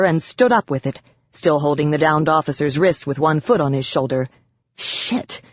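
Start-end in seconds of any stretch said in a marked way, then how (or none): 4.8-5.4 breathy whispered curse under breath. A narrator's reading. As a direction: hiss it under your breath. Narrator's private curse.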